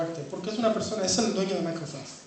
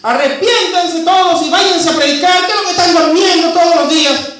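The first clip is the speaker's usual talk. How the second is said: loud, much higher urgent command; 11 semitones higher, 16 dB louder than usual. Retold or imitated. Preacher voicing Isaiah ordering the sleepers to preach, loud and high. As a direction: press it on them. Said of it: "diría yo, si Isaías".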